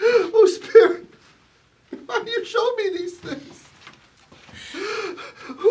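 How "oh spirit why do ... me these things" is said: anguished plea. Acted. Scrooge pleading with the ghost.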